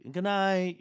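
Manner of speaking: high, loud sweet mom; sing-song goodnight like a mom. Retold or imitated. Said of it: "your mom's like".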